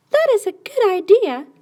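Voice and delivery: put-on a delighted little girl agreeing; little girl's voice.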